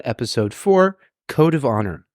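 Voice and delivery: usual speaking voice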